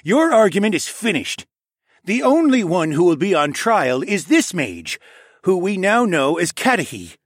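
loud, high, commanding royal voice; angry king. Acted. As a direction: end the argument as an irritated king.

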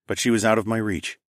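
plain reading voice

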